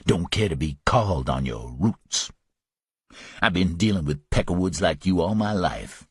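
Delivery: low, cool contempt; Louis, contemptuous. Acted. Louis insulting the southern thug, low and cold.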